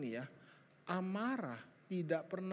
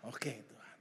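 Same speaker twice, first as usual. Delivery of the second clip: resigned prayer; 6 semitones lower, 7 dB quieter, slower than usual. Retold or imitated. Sighed surrender after deep breath.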